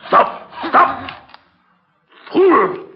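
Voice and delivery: high, loud outburst; angry shout. Acted. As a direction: yell furiously to halt the work. Madman halts captive's bad cut.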